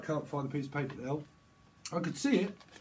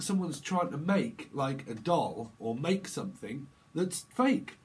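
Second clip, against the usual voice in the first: ordinary delivery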